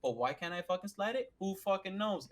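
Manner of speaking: normal talking voice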